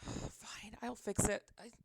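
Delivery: sigh, then whisper it to yourself; grumbling under the breath while fixing something; a resigned whisper. Real, not acted. Muttering to herself fixing stream.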